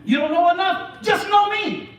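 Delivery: high, loud preacher's delivery; full-force preaching. Acted. Preacher urging the congregation to value their lives, full-force preaching. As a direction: drive it home to the congregation with rising fervour.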